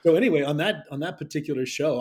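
normal talking voice

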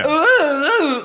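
high, loud mock dub ooh; strange dub voice. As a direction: squeal it like a bad dub. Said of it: "the voices are so strange".

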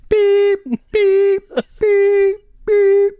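very high, loud flat beep; shrill loud mimic of a dial tone; busy tone beeps. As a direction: squeal beep like a phone tone. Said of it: "It's literally just saying it".

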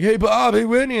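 loud high shout to the street; calling out. Retold or imitated. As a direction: call out from a car window. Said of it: "just like".

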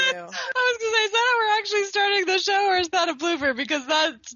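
high, fast giggly talk; amused high voice teasing the blooper; laughing excitement. Real, not acted. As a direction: speak through delighted laughter.

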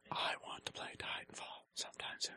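soft breathy whisper. Pretend. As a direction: whisper it like a secret. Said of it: "Why are we whispering?".

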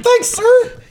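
very high, put-on squeaky teenage voice; hammed-up eager kid in a falsetto; fifteen-year-old boy. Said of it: "when I was, like, fifteen".

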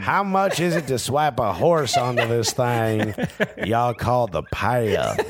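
slow, laughing mock Southern drawl; a hammed-up country bumpkin through laughter; put-on accent. Mocking a yokel asking about the PATH, slow put-on accent. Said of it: "I don't know what accent that is".

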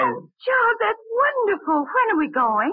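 high, thrilled gasp of joy; a wife lighting up at a surprise trip; delighted. Acted.

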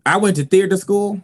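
usual speaking voice

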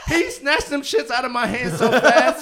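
loud, high laughing recount. Real, not acted. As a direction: laugh while telling it. Laughing while retelling.

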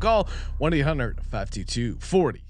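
rapid-fire terms and conditions; fast legal disclaimer. Acted. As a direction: rattle off the fine print fast.

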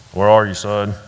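a hint of a terse army father; stern father. Military father answers on phone.

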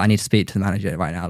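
quick, low, quiet demand; understated Karen demand. Retold or imitated. Retelling a demand for the manager, understated Karen. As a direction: rattle it off low and soft. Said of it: "this is very Karen".

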